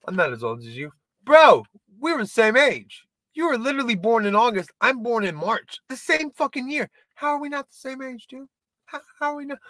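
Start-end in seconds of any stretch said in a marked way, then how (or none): 1.2-9.6 incredulous. Retold or imitated. Arguing with buddy about age.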